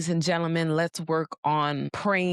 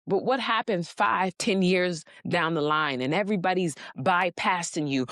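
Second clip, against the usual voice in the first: ordinary talk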